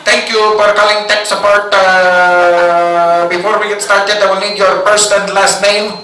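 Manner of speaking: put-on call-centre agent with Filipino accent; even, formal script in a put-on Filipino accent. Tech support agent greets caller, Filipino accent. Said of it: "it's the Filipinos".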